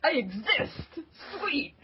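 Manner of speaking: high, slow, thrilled squeal of joy; a kid who just got noticed, over the moon; getting really giddy at a birthday shout-out, high and thrilled; giddy excitement. Retold or imitated. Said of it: "I'm like".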